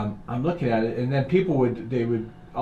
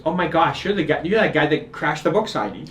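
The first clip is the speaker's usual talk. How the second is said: higher, louder, amused gasp of realisation; astonished recognition; 5 semitones higher, 5 dB louder than usual. Retold or imitated. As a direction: act out her sudden placing of him. Woman suddenly recognises him, retold.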